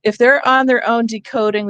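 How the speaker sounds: ordinary delivery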